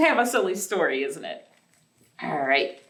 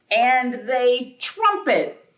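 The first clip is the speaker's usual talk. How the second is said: louder, slow and drawn out; big trumpet; 4 semitones higher, 6 dB louder, much slower than usual. Acted. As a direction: swell it up like an elephant trumpeting. Elephants trumpet before sleep.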